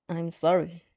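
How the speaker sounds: hoarse mumble. Acted. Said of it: "you mumble hoarsely".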